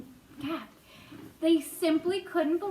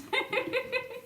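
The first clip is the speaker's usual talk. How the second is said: bright giggle; high delighted laugh; 9 semitones higher than usual. Real, not acted. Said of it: "I like that".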